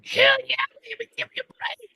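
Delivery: high, squeaky cheer; voicing the lightning round guy. Lightning round guy squealing for a break backstage. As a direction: squeal it like an eager little guy.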